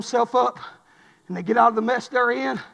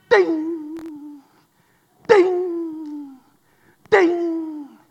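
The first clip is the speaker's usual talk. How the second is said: ringing, held "ding" fading away; three spaced bell strokes, each left to decay; imitated bell ding; 5 semitones higher, 4 dB louder, much slower than usual. Recruit ringing the bell to quit, imitated dings.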